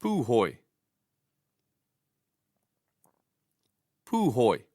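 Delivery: "puhoi" stressed on a long PUU; stress first long vowel. Shown as a demonstration. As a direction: say "puhoi" as POO-hoi. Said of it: "stress the first long vowel".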